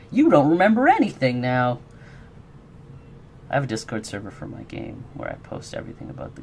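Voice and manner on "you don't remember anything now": higher, louder mock pronouncement; mock card pronouncement. Streamer voicing a mean card from the deck, mock pronouncement. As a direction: announce a cruel card effect, hammed up. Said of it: "draw a card and it's like".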